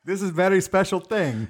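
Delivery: high-pitched mock foreign accent; Borat. Co-host joining the bit, Borat-like foreign accent. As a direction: join in with a broken-English accent. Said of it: "fucking Borat".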